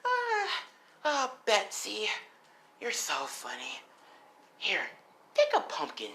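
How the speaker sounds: high sigh easing down after laughter; amused, teasing villain; mocking Gremlin. Gremlin offering Deku a pumpkin, mocking high voice.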